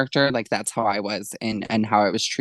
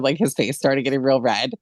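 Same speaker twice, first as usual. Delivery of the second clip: normal talking voice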